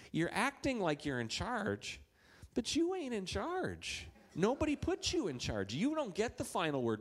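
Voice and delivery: telling off a pretender, high and deliberate; firm stand-up. Standing up to bossy person.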